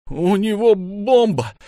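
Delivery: high, loud, drawn-out cry; woman's high-pitched shout; screamed warning. A narrator's reading. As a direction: scream the alarm, stretched out. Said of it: "она кричит уже мне".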